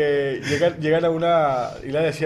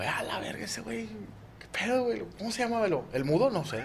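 hushed shock; 4 semitones higher, 9 dB quieter than usual. Acted. Awed onlookers after the death.

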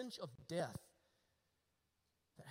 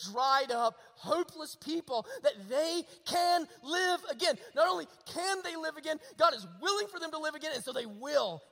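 high, loud, fervent exhortation; ringing pulpit proclamation; full-force preaching; 8 semitones higher, 10 dB louder than usual. Real, not acted. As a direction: drive it home to the congregation.

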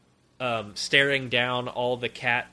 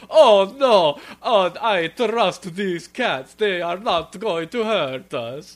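put-on high, slow accented old mouse, a bit like the Count; a gullible father drawled out slowly; Count-like Papa voice; 5 semitones higher, 5 dB louder, slower than usual. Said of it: "my Papa Mouskowitz voice".

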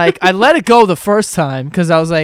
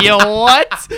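higher, louder incredulous question; 4 semitones higher, 6 dB louder than usual. Retold or imitated. Friends reacting to Espo's wrong reading at the bar, incredulous. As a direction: react in loud disbelief. Retold disbelief at wrong word.

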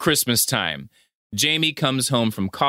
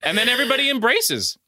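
excited; 11 semitones higher, much faster than usual. Real, not acted. Excited retelling, high fast.

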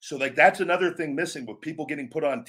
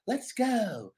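high, soft, sing-song Let's go; silly character catchphrase; 9 semitones higher, 4 dB quieter, much slower than usual. Shown as a demonstration. Wrestler showing a silly-character version, sing-song. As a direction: play it goofy. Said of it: "quirky, silly character".

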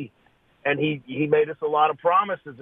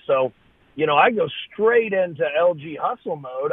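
ordinary talk, nothing marked

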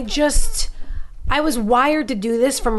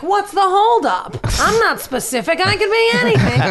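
exasperated, shouting for a break; indignant shout; 10 semitones higher than usual. Retold or imitated. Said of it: "I was always going like".

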